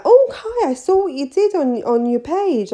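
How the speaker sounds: high, gushing client voice; excited client. Retold or imitated. Client telling the nail tech she saw her page, high and gushing. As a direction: gush like a fan who saw the post. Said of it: "they would be like".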